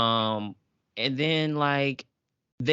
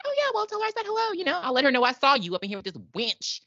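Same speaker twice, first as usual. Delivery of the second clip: much higher, fast catty mock voice; snide babysitter; 10 semitones higher, faster than usual. Recapping the scene, mocking the babysitter in a high fast catty voice. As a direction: toss it off sweetly and catty.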